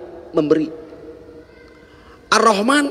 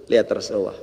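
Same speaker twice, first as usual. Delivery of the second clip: ordinary delivery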